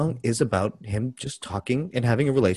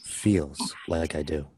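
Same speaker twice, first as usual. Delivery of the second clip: dramatic reading; 10 semitones lower, 7 dB quieter, slower than usual. Pretend. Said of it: "Give me a dramatic reading".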